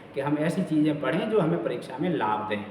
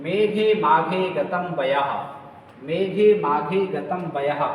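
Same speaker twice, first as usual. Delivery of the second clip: slow recitation; slower than usual. Shown as a demonstration. Teacher quotes Sanskrit maxim.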